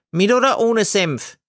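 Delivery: high, routine vendor voice; a bored vendor asking by rote; sausage seller. A narrator's reading. Gailana asks the nun about mustard, high and routine.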